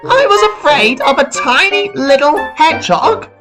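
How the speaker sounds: high-pitched disbelieving exclamation; Thomas astonished. A narrator's reading. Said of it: "Thomas yelled in surprise".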